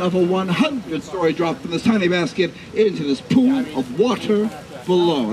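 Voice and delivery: normal talking voice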